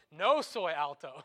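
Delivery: no soy alto, loud and slow; loud self-mocking Spanish. Shown as a demonstration. Preacher joking to the congregation he isn't tall, loud and slow. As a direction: proclaim no soy alto with a grin. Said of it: "Or soy".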